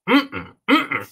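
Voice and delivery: high appreciative mm-mm; high hum of pleasure. Real, not acted. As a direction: hum with delight at the taste. Tasting the cocktail.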